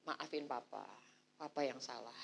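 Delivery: father apologizing. Retold or imitated. Father stepping forward for naughty children, low soft apology. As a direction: own the fault low and soft. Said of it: "Bapaknya maju".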